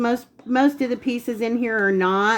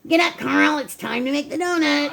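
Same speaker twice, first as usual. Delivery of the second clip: imitated wake-up call from an old advert; Dunkin' Donuts commercial guy; 2 semitones higher than usual. Baker imitating husband's morning wake-up, commercial-guy voice. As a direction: mimic the droning donut maker rousing someone. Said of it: "like the guy on the, the old Dunkin' Donuts".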